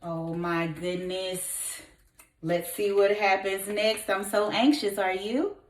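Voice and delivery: plain reading voice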